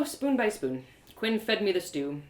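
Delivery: plain reading voice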